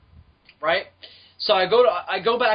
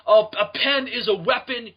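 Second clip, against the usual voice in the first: higher, slower mock rant with "pen" leaned on; mock angry dad; 5 semitones higher, 4 dB louder than usual. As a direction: scold like the kid's furious father. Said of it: "starts bitching at me".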